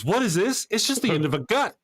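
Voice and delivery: high, puzzled mock astonishment; lightly voiced confused man; mock baffled surprise. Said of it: "the look on his face".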